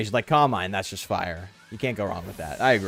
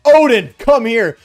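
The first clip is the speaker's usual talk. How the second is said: calling a dog; 5 semitones higher, 8 dB louder, slower than usual. Pretend. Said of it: "It's like".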